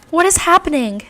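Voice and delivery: high, loud alarmed question; acted panic. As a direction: cry out, trapped and panicking. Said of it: "The trio starts to panic".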